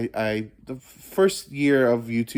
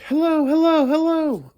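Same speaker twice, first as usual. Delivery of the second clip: very high, louder sing-song hellos; playful high-pitched hello; high mock greeting; 6 dB louder than usual. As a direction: call out in a squeaky cartoon voice. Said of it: "It's like".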